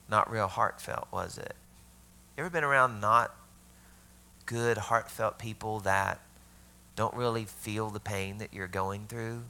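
ordinary delivery

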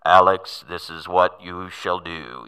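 low, slow, booming voice from above; mock-divine command, slow and weighty; God's audible voice. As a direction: pronounce it as God, loud and deep. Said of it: "an audible voice back".

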